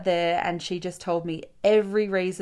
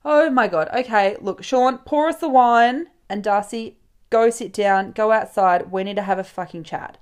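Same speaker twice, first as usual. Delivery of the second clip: loud, take-charge imitation; emphatic, commanding retold speech; bossy friend; 2 semitones higher, 8 dB louder than usual. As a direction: issue brisk orders like a no-nonsense best mate. Friend takes charge, orders everyone.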